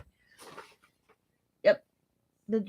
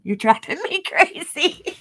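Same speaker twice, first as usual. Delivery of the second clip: laughing through quote; 9 semitones higher, 5 dB louder than usual. Retold or imitated. Husband's quote, breaks into laugh.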